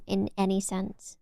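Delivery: usual speaking voice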